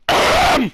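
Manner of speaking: harsh loud forced cough; loud throat clearing. Real, not acted. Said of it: "I think I actually damaged my voice".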